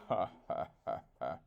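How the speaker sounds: low mocking vulture laugh. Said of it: "Vulture laughed at him".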